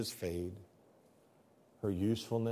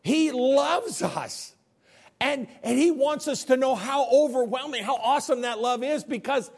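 raised, fervent sermon voice; impassioned preaching; 7 dB louder than usual. Acted. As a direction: proclaim it with awe and force. Preaches God's love.

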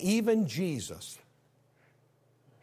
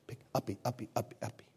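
put-on baby begging to be picked up; 6 semitones lower, 10 dB quieter, much faster than usual. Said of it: "goes like this".

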